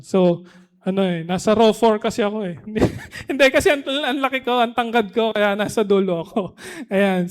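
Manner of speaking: ordinary delivery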